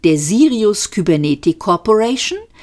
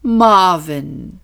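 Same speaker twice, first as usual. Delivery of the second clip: admonishing. A narrator's reading. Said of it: "mahnte Trillian".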